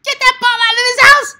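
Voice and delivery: very high, loud screeching yell; outrageous voice. Shown as a demonstration. Coach showing a parent how to yell at kids, outrageous shriek. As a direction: screech it like an over-the-top parent. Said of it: "You could do a voice like".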